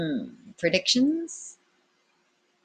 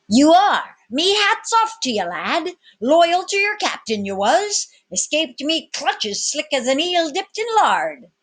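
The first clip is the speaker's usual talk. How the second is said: much higher, louder cockney-tinged tavern keeper; rough tavern keeper; 10 semitones higher, 4 dB louder than usual. A narrator's reading. Tavern keeper recognising the cabin boy, high and delighted. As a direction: crow it out in wonder at the lad.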